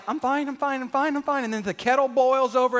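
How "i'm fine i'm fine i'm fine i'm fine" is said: higher, tight, bottled-up repeat; stuffer repeating fine. Acted. Pastor acting the stuffer, bottled-up and higher. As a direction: say it like you're about to burst. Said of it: "The stuffer is like".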